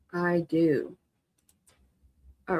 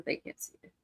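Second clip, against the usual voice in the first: whisper; 14 dB quieter than usual. Real, not acted. Aside while hiding family photo.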